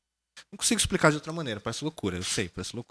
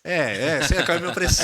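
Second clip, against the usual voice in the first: mock sceptic excuse; 5 semitones higher, 8 dB louder than usual. Mocking sceptic's excuse, laughing.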